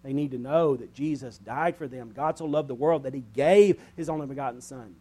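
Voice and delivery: usual speaking voice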